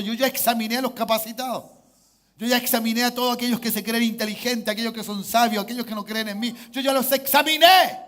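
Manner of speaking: insistent list building to a loud, high peak; forceful preaching. Acted. God declaring he examined the wise, preached with rising force. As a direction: build up and hit the last phrase hard.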